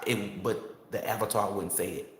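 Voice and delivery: ordinary delivery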